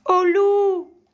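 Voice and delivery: high scream with all your might; very loud, drawn-out alarm cry; cri de toutes ses forces. A narrator's reading. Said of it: "criait de toutes ses forces". (cri aigu à pleins poumons)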